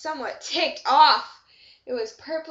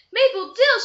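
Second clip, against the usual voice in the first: loud high alarmed call of a name; crying out loudly; 9 semitones higher, 10 dB louder than usual. Acted. Lorna May Loon cries out for her missing friend, loud. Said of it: "she cried loudly".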